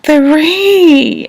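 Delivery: high, loud, drawn-out tearful squeal; overwhelmed surprise. Retold or imitated. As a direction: relive the shock of seeing it. Acts out seeing the ring.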